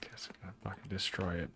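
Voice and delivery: whispered threat. Real, not acted. Streamer whispering a threat at his broken app. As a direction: whisper the threat under your breath.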